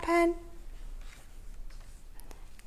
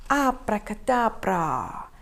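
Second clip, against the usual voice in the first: magic spell; 4 semitones lower than usual. Acted. Wizard spell incantation.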